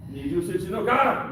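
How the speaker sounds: high, loud Japanese drill call; calling out commands. Real, not acted. Sensei naming the exercise, loud commanding call. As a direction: announce the form to the whole room.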